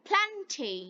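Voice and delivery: ordinary talk, nothing marked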